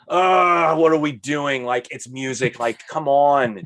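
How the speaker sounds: fed-up outburst at something ridiculous; exasperated. Retold or imitated. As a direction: protest in disbelief, dragging out the last words. Mimes own exasperated reaction to film.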